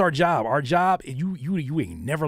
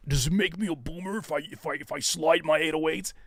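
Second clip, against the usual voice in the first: higher, fretful put-on voice asking permission; higher worried put-on asking if he's a boomer; mock anxious producer; 3 semitones higher than usual. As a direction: play the insecure beatmaker.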